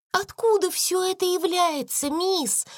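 high, astonished cry; amazed exclaim. Acted. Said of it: "воскликнула Бекки".

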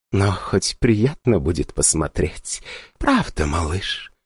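slow, soft sultry whisper. Acted. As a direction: breathe it out seductively. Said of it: "томно вздохнула".

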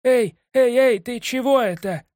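high loud panicked shout; alarmed cry. A narrator's reading. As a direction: yell it in alarm. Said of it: "вскричал пингвин Кощей".

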